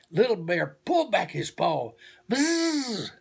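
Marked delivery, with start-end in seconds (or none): 2.3-3.1 high buzzing bee noise. A narrator's reading. Narrator imitating angry bees near the hive, high buzz. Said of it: "furious buzzing".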